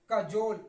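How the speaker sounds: loud कजोल, short 'kuh' and long 'jole'; correct pronunciation. Shown as a demonstration. Teacher drills the right reading, correct pronunciation. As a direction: land hard on the second syllable of कजोल. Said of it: "इसका सही उच्चारण क्या होगा".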